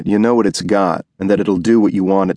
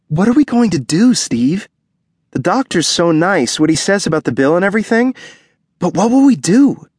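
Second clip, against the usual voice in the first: much higher, pleading wife's voice; higher, anxious voice of a young wife pleading with her husband; worried wife; 11 semitones higher than usual. Acted. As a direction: voice the wife fretting over the bill. Ruby voiced, worried about money.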